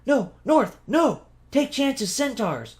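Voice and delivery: a panicked little cat stammering a warning; frantic cat yell. A narrator's reading. Bibby yelling at Tu not to go north, frantic squeak. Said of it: "Bibby yelled".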